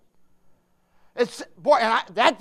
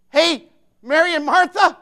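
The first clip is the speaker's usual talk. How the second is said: raised, higher preacher's call; 7 semitones higher, 8 dB louder than usual. Acted. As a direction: hail them loudly from the pulpit. Calls out God's message.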